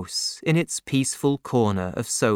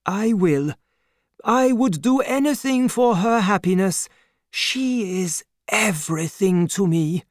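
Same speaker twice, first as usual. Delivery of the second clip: put-on higher, earnest old doctor; devoted old father; 7 semitones higher than usual. A narrator's reading. Doctor vows for daughter.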